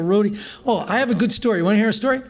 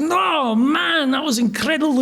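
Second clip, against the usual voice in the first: higher, imitated thick Scottish brogue; Scottish accent; 6 semitones higher than usual. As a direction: insist it in a Scottish rocker's accent. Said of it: "really thick Scottish accents".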